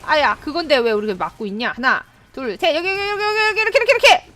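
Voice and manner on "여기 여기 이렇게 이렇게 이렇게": ordinary delivery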